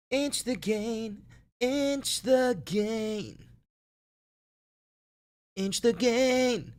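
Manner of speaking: milk the phrase like a command; dramatic drawn-out order; mock command. Repeating instruction theatrically.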